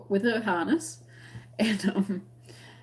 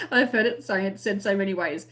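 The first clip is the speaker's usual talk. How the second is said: ordinary talk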